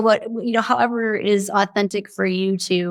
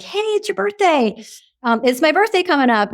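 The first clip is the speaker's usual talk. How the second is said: excited news; 8 semitones higher than usual. Retold or imitated. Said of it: "you might be all excited".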